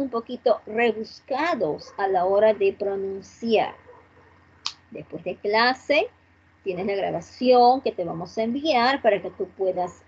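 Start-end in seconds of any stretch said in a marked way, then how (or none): none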